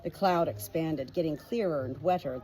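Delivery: ordinary delivery, nothing marked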